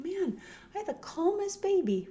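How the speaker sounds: high-pitched, mock gushing parent; imitated parent's high coo. Voicing parents praising calm baby.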